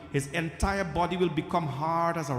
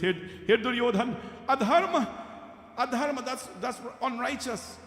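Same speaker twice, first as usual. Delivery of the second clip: outraged protest; 7 semitones higher than usual. Acted. Dying Duryodhan protests foul blow.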